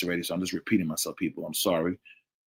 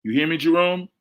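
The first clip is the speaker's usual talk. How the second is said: high, raised voice checking the line; insistent calling out; 7 semitones higher, 6 dB louder than usual. Real, not acted. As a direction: call each listener, pitched up and questioning. Calling listeners over faulty audio.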